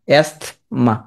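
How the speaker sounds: অ্যাস্থমা split as "est ... ma"; spelling pronunciation. Shown as a demonstration. Teacher voicing silent letters, split অ্যাস্থমা. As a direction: sound the s and t in অ্যাস্থমা, pause before "ma". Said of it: "আমি উচ্চারণ করে লেখো".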